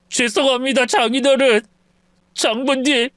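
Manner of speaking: a broken son-in-law giving up; anguished confession. Acted. (이혼을 선언하는 괴로운 사위)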